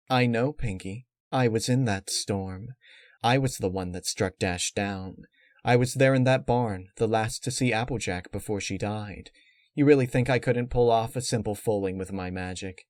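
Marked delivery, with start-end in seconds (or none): none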